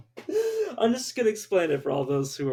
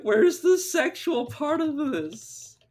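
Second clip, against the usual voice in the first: incredulous quote; 13 semitones higher, 6 dB louder, much slower than usual. Retold or imitated. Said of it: "I'm like".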